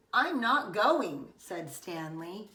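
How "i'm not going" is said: slow, louder, dug-in refusal; stubborn refusal. Acted. Boy refusing to leave the bathroom, slow and stubborn. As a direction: plant your feet and refuse.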